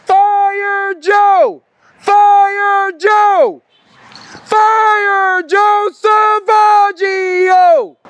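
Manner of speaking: drawn-out protest chant, shouted; yelling. Real, not acted. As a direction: yell the slogan out on the sidewalk. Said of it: "I have no choice but to yell it out here".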